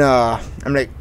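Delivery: usual speaking voice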